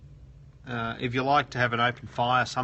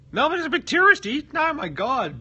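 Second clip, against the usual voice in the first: much higher and louder indignant cry; mock outrage; 8 dB louder than usual. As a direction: protest in fake shock. Mock outrage at viewer's claim.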